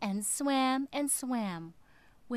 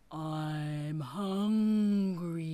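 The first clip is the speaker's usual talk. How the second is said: low, very slow whale voice; 6 semitones lower, much slower than usual. Acted. Whale tells the fish he is hungry, low and slow. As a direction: drag it out like a huge hungry whale.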